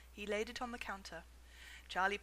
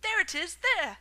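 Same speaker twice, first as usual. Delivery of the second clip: loud, higher pointing shout; 8 semitones higher, 12 dB louder than usual. A narrator's reading. Someone else shouting at the ticket, loud and high. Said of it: "someone else shouted".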